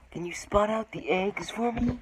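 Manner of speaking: slow, eager preschool TV question to the viewer; Dora the Explorer. Acted. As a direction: ask the audience like a cartoon explorer. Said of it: "trying to do something like Dora the Explorer".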